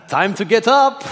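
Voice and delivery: put-on loud, high French-accented wake-up call; French accent shout. Farmer rousing volunteer at five, loud French accent. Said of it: "I told you I wouldn't do the accent".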